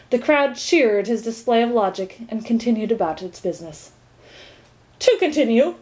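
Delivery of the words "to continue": higher, louder, impatient resumption. A narrator's reading. Minister resumes his interrupted explanation, impatiently. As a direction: push firmly back to the subject. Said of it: "continued the minister impatiently".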